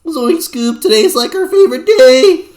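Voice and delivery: high, loud goofy stoner drawl; Shaggy stoner voice. Retold or imitated. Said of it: "Scooby-Doo and Shaggy".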